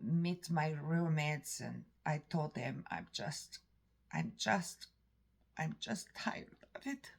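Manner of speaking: normal talking voice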